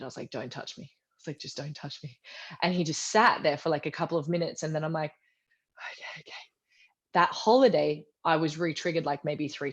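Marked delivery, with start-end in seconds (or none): 0.3-2.2 quiet plea. Retold or imitated. Triggered, pushing partner away. 5.9-6.3 whispered, settling down. Retold or imitated. Calming down beside her partner, whispering.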